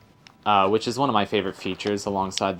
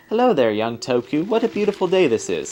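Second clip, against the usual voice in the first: put-on old lady's greeting, pitch swooping up; lilting old-lady character voice; 3 semitones higher, 3 dB louder than usual. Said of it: "old, old lady, it looks like".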